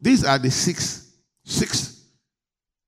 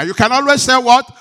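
higher and much louder call; 8 semitones higher, 11 dB louder than usual. Real, not acted. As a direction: push the question bigger. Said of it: "say it bigger".

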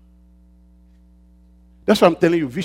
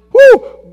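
high, loud joyful whoop; exultant whoop; 9 dB louder than usual. Real, not acted. Preacher stirring the church, a high joyful whoop. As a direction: let out a shout of glory.

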